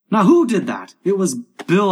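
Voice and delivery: high, loud crew squabble; accusing call. Acted. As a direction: demand loudly who is to blame. Crew quarrel after the crash.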